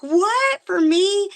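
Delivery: high, loud "What? For me?" in amazement; retold astonishment. Retells her own astonished reaction.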